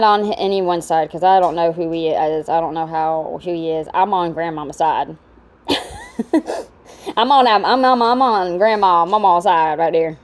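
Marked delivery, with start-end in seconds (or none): 5.7-6.6 burst of laughter. Real, not acted. Laughing at the video.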